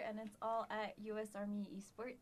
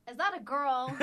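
mock gamer guy; 4 semitones higher, 13 dB louder than usual. Sexist gamer reacting to her voice.

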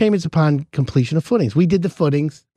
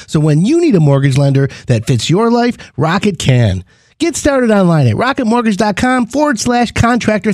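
bright, louder sponsor read; 5 dB louder than usual. Acted. Host reading the mortgage ad to listeners, upbeat ad read. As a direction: sell it like a radio spot.

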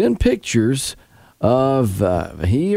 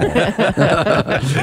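real laugh at the prank; 5 semitones higher than usual. Real, not acted. Co-host laughs.

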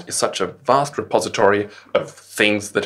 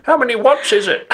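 silly character voice; 4 dB louder than usual. Pretend. Mock astronaut at the shop.

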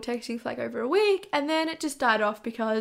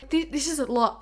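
usual speaking voice

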